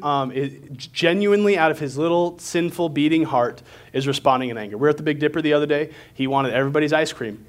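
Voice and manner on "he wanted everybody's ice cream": ordinary delivery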